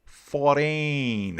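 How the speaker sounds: a light mock Australian accent on the numbers; imitating an Australian accent. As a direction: count it like an Aussie, half-joking. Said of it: "It's kinda like the Australians".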